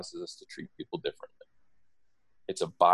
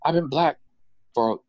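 high-pitched, insistent mock defense; playful, emphatic back-talk; acted protest; 9 semitones higher than usual. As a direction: plead your case to mom in a raised voice. Acted protest to scolding mother.

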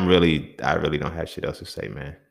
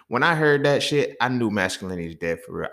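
ordinary delivery

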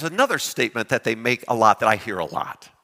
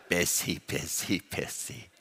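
each busy lower and softer, fading into a whisper; 7 semitones lower, 11 dB quieter, slower than usual. Retold or imitated. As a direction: let the busies die away to a breath. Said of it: "the three busy person".

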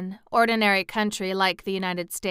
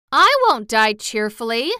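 loud, higher-pitched protest; 4 semitones higher, 9 dB louder than usual. A narrator's reading. Kitten refusing to die cheerfully, protesting. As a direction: object firmly, voice raised. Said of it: "protested the kitten".

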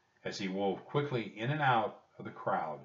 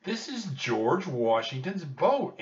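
high, loud, drawn-out exclamation; eager little-girl excitement, pitched way up; excited; 9 semitones higher, 6 dB louder, slower than usual. A narrator's reading. Annie urging Jack to stay on Washington's boat, excited.

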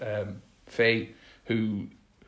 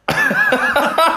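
sudden shrieking laugh; loud laugh; 9 dB louder than usual. Real, not acted. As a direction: burst of high loud laughter. Reacting to sexual joke.

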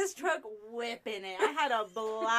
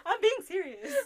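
high, helpless laughing; cracking up while protesting; 12 semitones higher, 8 dB louder than usual. Real, not acted. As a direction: insist through real laughter.

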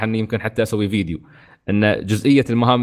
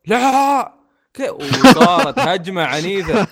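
high, slow, laughing retelling; amused disbelief; 6 semitones higher, 4 dB louder, much slower than usual. Real, not acted. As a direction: chuckle through the story. Laughing retelling of fan attack.

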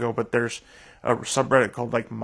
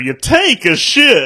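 slow, louder, high mock comedian delivery; Seinfeld bit; 10 semitones higher, 10 dB louder, slower than usual. Quoting the Seinfeld bit to a friend, slow and loud. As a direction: drag out the comic line like a stand-up bit. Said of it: "that Jerry Seinfeld bit".

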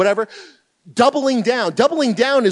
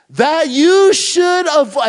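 higher, louder, slow; loud reading; 7 semitones higher, 7 dB louder, slower than usual. Retold or imitated. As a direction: push into the verse boldly. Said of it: "we get into more specifics".

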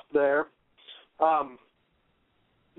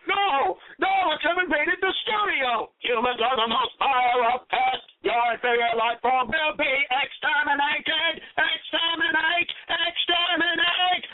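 higher, slow menacing Dalek; drawn-out robotic EXTERMINATE commands; booming arrogant alien overlord; 10 semitones higher than usual. Acted. Daleks invade the ad.

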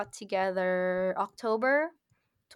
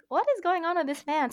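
high-pitched, baffled exclamation; bewildered; 8 semitones higher than usual. Retold or imitated. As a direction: retell it in high-pitched disbelief. Bewildered reaction to his message.

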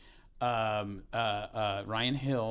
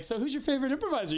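much higher, fast leading question; coaxing dad question; 12 semitones higher, faster than usual. Retold or imitated. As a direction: bait the kid sweetly. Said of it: "I was like".

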